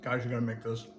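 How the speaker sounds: talking through a mouthful of pie; mouth full. Real, not acted. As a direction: speak around a mouthful. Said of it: "talking with my mouth full".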